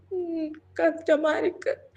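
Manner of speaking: small slow sniffly voice, acted; halting, whimpering complaint; tearful. A narrator's reading. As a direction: admit it between mock sobs. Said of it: "என்ன நீ அழுதுகிட்டு இருக்க".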